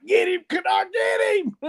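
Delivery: high-pitched excited yell; screaming. Retold or imitated. Cohost recalls cheering Kennard during last week's game, screaming. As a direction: re-enact screaming for the player, pitch shooting way up. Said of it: "I was screaming like".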